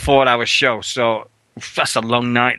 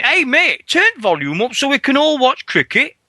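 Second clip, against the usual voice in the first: imitating a train chav; 12 semitones higher than usual. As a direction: ham up the cricket fan on the train. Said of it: "he's going".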